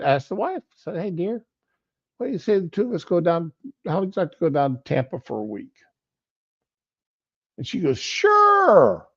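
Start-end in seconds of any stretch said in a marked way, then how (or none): 1.0-5.8 fast, quiet coaxing husband; quick sweet-talking. Retold or imitated. Husband quickly coaxing his wife toward Tampa, fast and hushed. 8.0-9.1 much higher, louder eager yelp; eager yes. Retold or imitated. Wife jumping at the Tampa trip, high eager yelp. Wife's eager answer retold.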